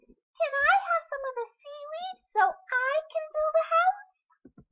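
very high squeaky little fish. A narrator's reading. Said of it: "met a seahorse".

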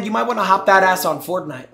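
ordinary talk

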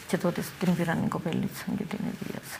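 hushed, breathless whisper of the worst moment. Real, not acted. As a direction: drop to a whisper as if unable to say it.

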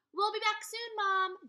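high, loud call to another room. Acted. Jack calling to Mom in the living room, loud call.

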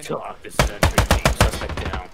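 radio voice. Pretend. Mock radio callout.

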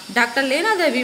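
plain reading voice

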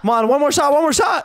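combat callout. Real, not acted. Urging the final shot.

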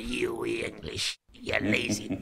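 put-on Scottish accent, rough and contemptuous. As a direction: growl it like a raging Scottish boss. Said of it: "I forgive the accents".

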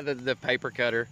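ordinary delivery

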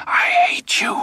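hissed whisper of hatred. A narrator's reading. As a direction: breathe the words with hate. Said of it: "he whispered".

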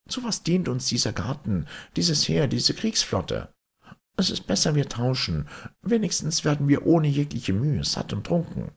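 high, eager merchant voice, talking himself into the trade; the merchants, pitched high, deciding to swap for easy food and drink; greedy merchants. Acted. Merchants confer among themselves.

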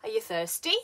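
THIRSTY rises at the end; rising intonation. Shown as a demonstration. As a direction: lift "thirsty" into a yes-or-no question. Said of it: "used mainly in questions that expect".

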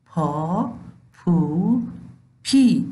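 پ joined to the long vowels, پا پو پی; teacher drilling پ with long vowels; syllable drill. Shown as a demonstration. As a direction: sound پ with each long vowel. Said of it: "با صدادارهای بلند".

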